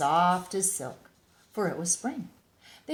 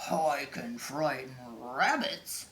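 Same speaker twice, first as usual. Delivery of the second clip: slow, lower bear voice; growly bear; 5 semitones lower than usual. A narrator's reading. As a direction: boast like a big bear. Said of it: "said the large and growly bear".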